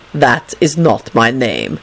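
put-on low, slow foreign-accented voice; Dragomir's accented retort. A narrator's reading. Dragomir correcting dazed Lupin, low accented retort. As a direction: correct him slowly and deeply. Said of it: "Dragomir replied quickly".

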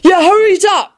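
high-pitched sulky nagging; impatient high whine. A narrator's reading. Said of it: "Ron sulked".

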